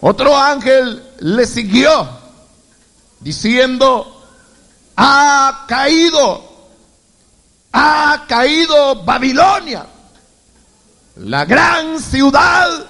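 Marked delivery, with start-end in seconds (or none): 5.0-12.9 proclaiming. A narrator's reading. Angel proclaims Babylon's fall.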